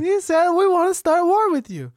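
very high secretary voice; put-on high-pitched character, accented grammar; secretary Sasha. Secretary reports Putin's threat, very high voice.

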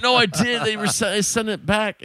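mock-indignant denial; retold protest. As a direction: insist you already did it, pitch up. Retold argument over unpaid bill.

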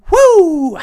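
loud high whoop. Real, not acted. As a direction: let out a big celebratory woo. Sudden celebratory yell.

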